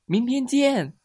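high, loud goodbye; cheerful farewell call. Acted. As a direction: call out happily as friends part. Animals call goodbye to each other. (高声欢快地道别)

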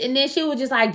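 ordinary talk

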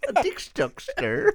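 put-on old-timey voice. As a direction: parrot it in a squeaky old-timey drawl. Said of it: "old-timey, like, voice in my head".

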